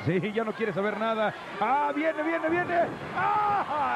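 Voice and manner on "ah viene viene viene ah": excited wrestling call. Real, not acted. Live play-by-play excitement.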